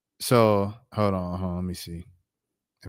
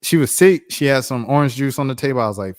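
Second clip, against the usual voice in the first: ordinary delivery, nothing marked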